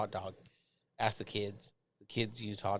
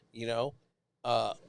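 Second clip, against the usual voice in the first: ordinary talk, nothing marked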